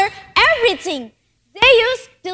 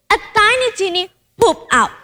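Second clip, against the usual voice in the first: usual speaking voice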